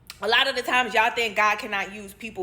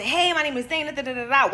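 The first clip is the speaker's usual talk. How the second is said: put-on cheerful greeting; 5 semitones higher than usual. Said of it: "wipe the sadness off my face".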